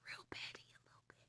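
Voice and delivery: quick whispered aside. Real, not acted. Narrator whispering to viewers that Jesus is petty. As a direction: whisper it like gossip, fast.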